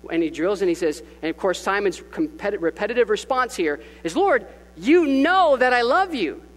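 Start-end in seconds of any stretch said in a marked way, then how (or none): 4.1-6.4 earnest plea. Retold or imitated. Peter's repeated answer quoted.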